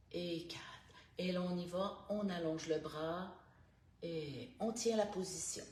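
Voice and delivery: calm, unhurried instructor guiding each breath; guided stretching voice. Acted. Morning stretch coach guiding listeners through neck and shoulders, calm. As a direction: talk the class softly through every stretch.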